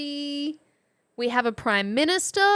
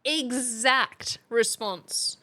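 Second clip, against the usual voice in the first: slow, emphatic; much slower than usual. Real, not acted. Echoing for emphasis.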